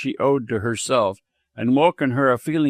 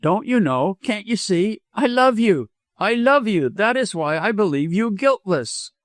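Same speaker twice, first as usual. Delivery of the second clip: impassioned, higher-pitched declaration; carried away; 8 semitones higher than usual. A narrator's reading. Doctor confesses love to the widow, carried away. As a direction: blurt out love, pitch lifted high. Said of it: "carried out of his usual equable self".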